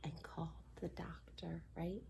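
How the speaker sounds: whispered story line. Acted. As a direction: whisper it like a secret.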